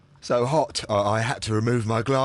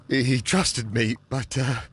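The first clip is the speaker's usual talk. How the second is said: usual speaking voice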